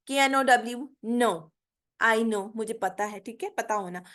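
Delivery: usual speaking voice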